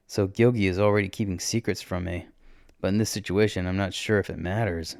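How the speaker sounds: ordinary delivery, nothing marked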